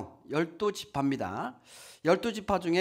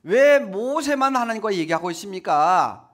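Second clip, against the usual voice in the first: louder, drawn-out mock protest; grumbling complaint; 5 semitones higher, 6 dB louder than usual. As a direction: whine it up at God like the grumbling crowd. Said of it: "막 이렇게 원망하는 거예요". (크게 원망하듯 항의하는 흉내)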